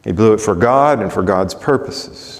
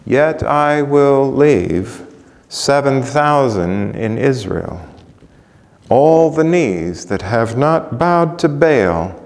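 slow; 2 semitones higher, slower than usual. Acted. God's promise, read slowly.

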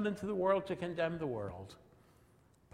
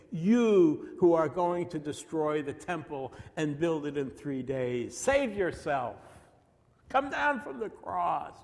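hurled insults; 2 semitones higher, 5 dB louder than usual. Acted. Passers-by insulting Jesus on the cross, loud taunting. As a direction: hurl it as a jeering challenge. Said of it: "hurled insults at him".

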